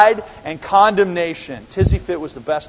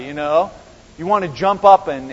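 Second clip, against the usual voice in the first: ordinary talk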